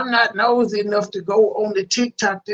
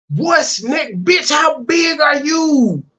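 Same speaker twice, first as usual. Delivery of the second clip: incredula, forte e acuta, rallentando; sbotto indignato; 7 semitones higher, 5 dB louder, slower than usual. Real, not acted. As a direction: urla la domanda strascicandola, scandalizzato. Outraged echo of the woman's question.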